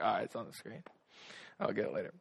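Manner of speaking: lazy brush-off. Retold or imitated. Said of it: "I'm lazy".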